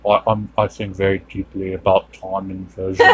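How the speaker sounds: boring Nolan impression. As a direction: drone on like the dullest man alive. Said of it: "doesn't he sound like the most boring man".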